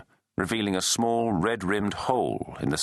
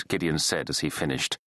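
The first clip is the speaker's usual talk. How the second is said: usual speaking voice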